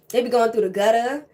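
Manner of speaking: ordinary delivery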